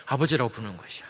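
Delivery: ordinary talk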